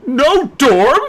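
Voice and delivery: high, loud flustered squawk of disbelief; Crowley can't believe it; head mage shocked at the mirror's verdict, crying out. Acted. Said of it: "Crowley cried".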